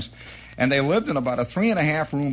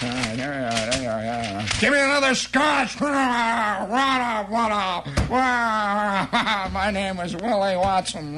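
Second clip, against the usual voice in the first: tipsy celebrity; 4 semitones higher than usual. Acted. Celebrity signing autographs.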